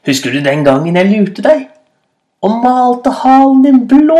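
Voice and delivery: building to a high, loud cry; taunting call. A narrator's reading. Rabbit reminding hyena of a trick, shouting tauntingly. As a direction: start near normal, climb to a gleeful yell. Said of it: "ropte Kanin".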